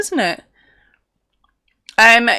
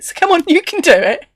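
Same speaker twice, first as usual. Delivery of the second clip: bright, higher sing-song cheer; mock cheerful coaxing with a teasing edge; teasing encouragement; 7 semitones higher, 4 dB louder than usual. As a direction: cheer her on with a wicked grin. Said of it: "Proper evil".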